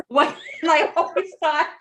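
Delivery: laughing while talking; words spilling out through a laugh. Real, not acted. Laughing at Norm's joke while telling it. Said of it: "I laughed so hard at that".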